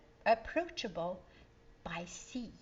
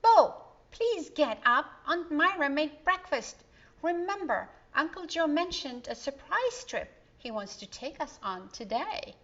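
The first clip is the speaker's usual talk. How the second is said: put-on higher, louder young girl urging her brother; a bright girl calling her brother awake; Donna's voice; 6 semitones higher, 6 dB louder than usual. A narrator's reading. Girl waking her brother for breakfast, higher and eager.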